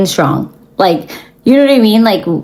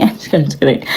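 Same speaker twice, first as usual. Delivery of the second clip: softer, laughing through the words; 4 semitones lower, 4 dB quieter, much faster than usual. Real, not acted. Laughing off her own rude word to viewers, softly giggling. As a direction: giggle as you take it back.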